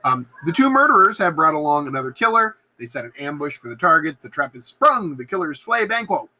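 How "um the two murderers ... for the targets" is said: usual speaking voice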